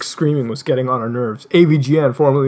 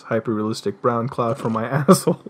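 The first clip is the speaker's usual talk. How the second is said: ordinary delivery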